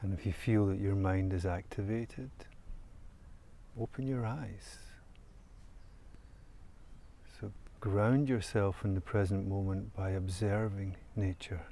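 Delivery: calm, soft meditation voice with long pauses; guided meditation. Real, not acted. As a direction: guide listeners gently into stillness, leaving long silences. Leads a guided nature meditation.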